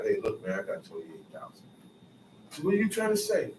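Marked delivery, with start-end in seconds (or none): 0.0-1.6 low, much softer hushed pitch to a judge; sly bribe offer. Retold or imitated. Briber quietly tempting the judge, low and hushed. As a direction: slip the judge a quiet offer under the table. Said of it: "Today they call it bribe".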